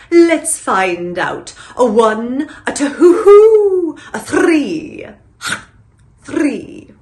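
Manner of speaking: slow, drawn-out owl counting with hoots; cartoon owl. Owl answering the kid's candy question, slow hooting impression. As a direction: count slowly like a wise commercial owl. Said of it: "And the owl would go".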